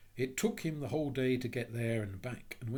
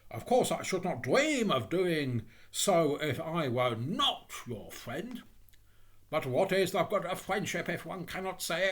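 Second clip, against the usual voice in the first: louder, emphatic swoops in pitch; pompous, lecturing miller; 4 semitones higher, 4 dB louder than usual. Acted. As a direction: lecture a friend grandly, leaning on key words.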